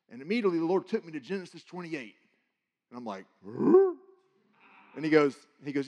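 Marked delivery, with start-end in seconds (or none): 3.4-4.0 surprised huh. Retold or imitated. Dream man names Genesis passage.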